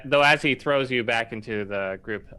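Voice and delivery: ordinary delivery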